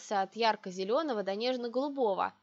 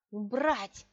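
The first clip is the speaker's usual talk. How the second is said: enraged hiss. A narrator's reading. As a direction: hiss the order in contained fury. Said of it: "прошипела взбешённая до крайности". (взбешённое шипение)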